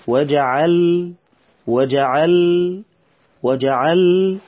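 وجعل repeated slowly, stopping on a clear lam. Shown as a demonstration. Teacher drills the lam for the children, slow. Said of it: "بالوقوف على اللام".

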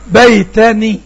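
بيتني said loud, each sound clear. Shown as a demonstration. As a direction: say بيتني loud for the class to judge. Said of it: "سمعتها ايه". (نطق بيتني بصوت عالٍ للتوضيح)

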